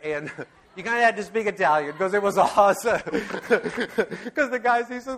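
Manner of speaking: laughing through the words; can't get it out for laughing. Real, not acted. Remembering the Totò film, laughing while he talks.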